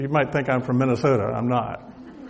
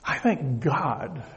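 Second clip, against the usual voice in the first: much softer, slow hushed repeat; softer echo; 8 dB quieter, much slower than usual. Real, not acted. Preacher echoing his thanks to the congregation, hushed and slow. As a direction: let it fall to a hush, slowly.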